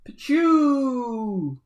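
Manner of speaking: loud, high zapping sound effect; loud comic pew noise; cartoon blast-off noise. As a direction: shout a silly exit zap.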